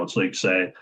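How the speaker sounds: ordinary talk, nothing marked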